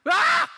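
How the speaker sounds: short, very high and loud yell of fright; acted-out shriek of shock; startled scream. Disciples take Jesus for a ghost, startled scream. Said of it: "소리를 질렀어요".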